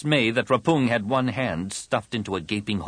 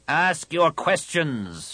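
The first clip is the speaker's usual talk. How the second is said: slow, high, scornful; contemptuous; 7 semitones higher, much slower than usual. A narrator's reading. Shaper mocking the shamed warrior, slow and contemptuous. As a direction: drag it out with disdain.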